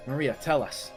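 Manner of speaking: normal talking voice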